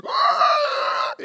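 high breathy shriek; monster screech. Acted. Preacher mocking movie demons with a raptor shriek. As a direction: rasp out a velociraptor cry.

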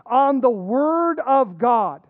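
Word of God drawn out slow and leaned on; slow emphasis. Real, not acted. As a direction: pastor stressing the Word of God to the congregation, slowly and firmly. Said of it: "I want you to notice the".

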